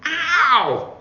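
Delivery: sharp high cry of pain; pained wail. A narrator's reading. Child yelping at a sudden toothache. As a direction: yelp as the tooth stabs. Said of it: "Sandy wailed".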